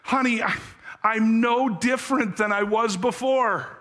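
a broken man confessing through tears to his wife; mild tearful plea. Acted. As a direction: voice lifted high with tearful pleading. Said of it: "he answered through his tears".

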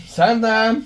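loud call across the house. Real, not acted. Cook calls Sandra to the table, a loud call. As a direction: call her in, loud and bright. Calling child that food's ready.

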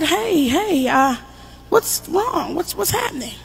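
hushed, gentle concern; soft concerned asking. Retold or imitated. Mother softly asking the crying teacher what happened. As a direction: ease in softly, worried.

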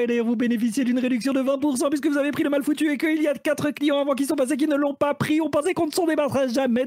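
high, fast shopping-channel pitch; infomercial host. Acted. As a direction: hype the discount like a TV deal. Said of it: "je serai à la manière d'un téléachat". (animateur de téléachat survolté)

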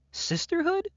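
high, loud sneering question; mocking shriek. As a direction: spit out the word in scorn. Mocks friendship bracelet.

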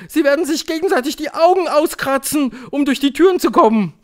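high, loud cry from an excited audience; shouting crowd. A narrator's reading. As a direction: yell it out over the crowd. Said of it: "schrie das Publikum".

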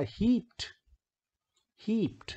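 "हीप्ड" as one high syllable ending in crisp t. Shown as a demonstration. Teacher models the rule on heaped, -ed pronounced "t". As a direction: say "हीप्ड" as "heept". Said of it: "ट बोला जा रहा है आखिरी".

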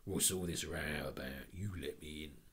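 policeman. Acted. Policeman at the window.

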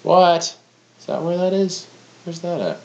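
high, loud startled what; suddenly surprised and confused. Real, not acted. Surprised at the map.

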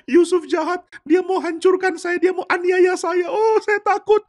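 very high hysterical wail; fake crying. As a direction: wail as a pretend victim. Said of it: "Dia pura-pura menangis".

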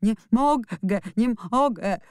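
put-on boy wailing he can't stop crying; sobbing wail. A narrator's reading. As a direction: beg through sobs. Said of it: "zawodził i szlochał".

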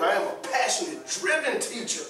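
proud, eager self-introduction; upbeat pitch line. Shown as a demonstration. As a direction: sell yourself with energy. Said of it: "But if you put".

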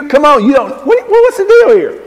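high-pitched mock disbelief; exasperated, rising protest; incredulous challenge. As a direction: sputter upward as you call him out. Mock challenge to Peter over denial.

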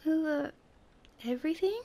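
high, halting, rising echo; incredulous question. A narrator's reading. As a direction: question it in amazement, pausing midway. Prince echoes king in disbelief.

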